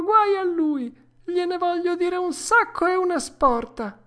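put-on high, loud puppet-boy lament turning to threat; remorseful Pinocchio. A narrator's reading. Pinocchio confessing to the dormouse, high, loud and remorseful. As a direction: wail out regret like a child, then turn indignant.

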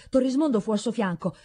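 ordinary delivery, nothing marked

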